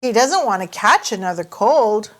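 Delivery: ordinary delivery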